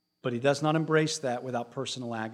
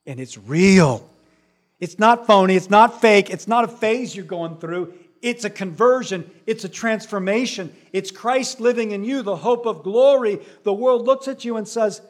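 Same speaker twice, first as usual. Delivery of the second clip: much louder, hammer each not; full-force preaching; 5 semitones higher, 8 dB louder than usual. Acted. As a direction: proclaim it to the whole church.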